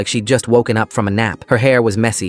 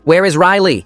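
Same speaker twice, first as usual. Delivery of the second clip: panicked scream, pitched up and louder; 8 semitones higher, 4 dB louder than usual. A narrator's reading. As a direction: shout it in a panic. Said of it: "I screamed".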